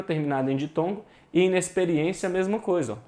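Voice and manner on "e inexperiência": ordinary delivery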